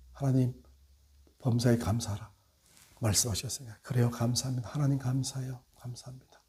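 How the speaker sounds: soft, hushed thanks to God, fading away; softly praying thanks; quiet thankful prayer. Shown as a demonstration. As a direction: murmur a grateful prayer under the breath. Preacher acts a thankful prayer.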